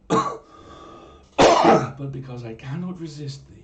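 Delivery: slow, loud overwrought confession; hammed-up lovestruck. Conchobar confessing to the mermaid, slow and loud. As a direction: ham up his helpless love.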